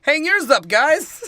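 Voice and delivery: high, loud New Yorker; mock neighborly shout. Acted New Yorker urging the neighbors.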